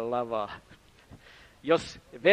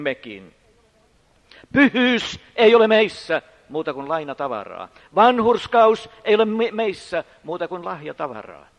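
loud, ringing pulpit voice; full-force preaching; 6 semitones higher, 8 dB louder than usual. Acted. Preacher proclaiming loudly to the congregation, full force. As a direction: drive it home to the congregation.

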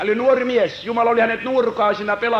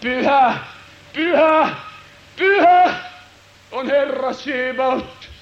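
divine proclamation; 3 semitones higher, slower than usual. Acted. Heavenly voice proclaiming holiness, building proclamation. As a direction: swell the holy to a peak. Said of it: "jumalallista ääntä".

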